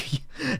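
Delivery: short high laugh breaking out; high giggle. Real, not acted. Laughing at her own story, high giggle. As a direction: giggle at the memory.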